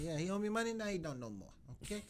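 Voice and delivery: hammed-up Italian mobster accent. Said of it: "two terrible Italian accents".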